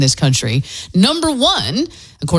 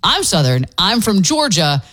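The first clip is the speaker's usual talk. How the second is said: ordinary talk, nothing marked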